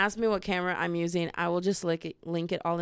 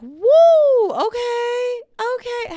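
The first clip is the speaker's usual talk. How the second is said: high, loud drawn-out party woo; squealed tipsy cheer; drunk whooping; 10 dB louder, much slower than usual. Retold or imitated. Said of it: "out there like".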